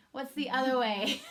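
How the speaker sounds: asking through a laugh; laughing along. Real, not acted. Teacher laughing as her daughter laughs at her. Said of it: "she's laughing at me".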